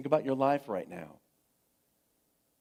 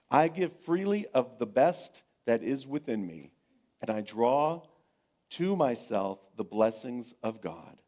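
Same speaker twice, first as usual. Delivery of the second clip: affirmation reading; 4 dB louder than usual. Acted. Reads the affirmation.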